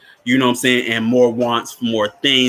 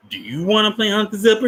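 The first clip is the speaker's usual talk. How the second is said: teasing, higher question rising at the end; higher coaxing voice; 9 semitones higher than usual. Retold or imitated. Counselor coaxing Bernard, higher and teasing. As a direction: lure the boy into the game.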